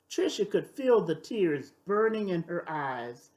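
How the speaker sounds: ordinary delivery, nothing marked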